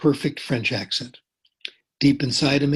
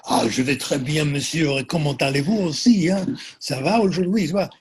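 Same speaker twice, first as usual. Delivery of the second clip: hammed-up French accent, mocking the teacher; mock French accent, pitch rising toward the end; exaggerated French accent; 3 semitones higher than usual. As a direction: overdo the French accent like a sneering teenager. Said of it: "spoke with an exaggerated French accent".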